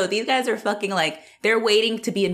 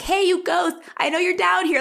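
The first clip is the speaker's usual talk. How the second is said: put-on much higher, bold kid calling out; mock shout; 11 semitones higher than usual. Kid in the basement, shouting at a ghost. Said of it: "I would shout".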